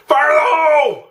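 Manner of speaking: high, loud cry of alarm; alarmed shout. Real, not acted. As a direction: yell the warning in a panic. Wax burning on the stove.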